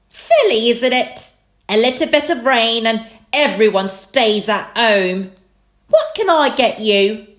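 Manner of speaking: high, loud chatty shopkeeper; Miss Rabbit. A narrator's reading. Miss Rabbit serves at the empty supermarket, high and chatty. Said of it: "Miss Rabbit was all alone".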